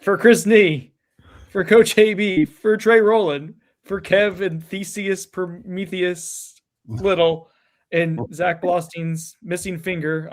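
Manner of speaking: grand podcast outro, slow and loud; sign-off dedication. Acted. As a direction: call out each name like an emcee. Show sign-off dedication roll call.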